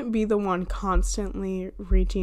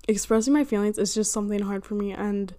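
normal talking voice

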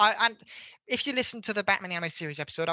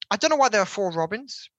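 normal talking voice

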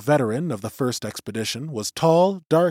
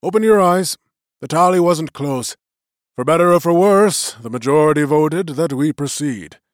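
lightly voiced elder, raised and formal; magician's voice; 4 semitones higher, 7 dB louder than usual. A narrator's reading. Old magician opening the vote to the clan, lightly voiced and raised. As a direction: address the gathering like a presiding elder.

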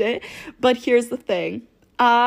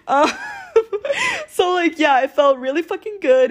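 laughing through the words, voice up and louder; real giggle breaking into the telling; 8 semitones higher, 3 dB louder than usual. Real, not acted. Telling her viewers how good it felt, laughing. Laughing while recalling the night.

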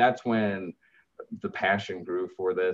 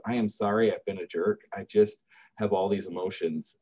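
usual speaking voice

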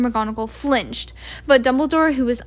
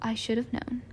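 muttering to himself; 8 dB quieter than usual. A narrator's reading. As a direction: murmur it to yourself, half amused. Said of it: "muttered to himself".

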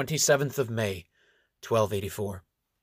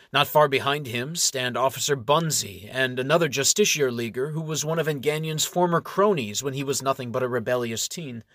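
ordinary delivery, nothing marked

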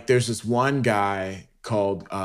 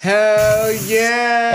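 excited; 12 semitones higher, 6 dB louder than usual. Real, not acted. Excited reaction to friend.

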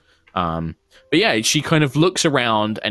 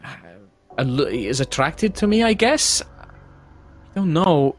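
uncertain Irina; 7 semitones higher than usual. Acted.

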